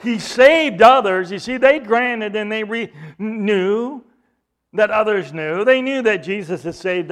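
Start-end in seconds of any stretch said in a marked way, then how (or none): 0.0-1.3 loud slow sneer; mocking. Chief priests mocking Jesus among themselves, loud slow sneer. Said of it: "were mocking him among themselves".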